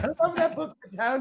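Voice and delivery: very high lilting impersonation, half laughing; high sing-song with a laugh in it; super sing-songy. Retold or imitated. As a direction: gush it musically in a high imitated voice. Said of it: "like, super sing-songy".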